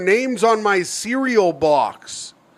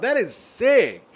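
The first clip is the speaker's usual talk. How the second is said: ordinary delivery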